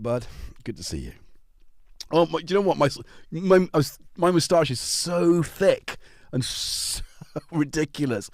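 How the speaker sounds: ordinary talk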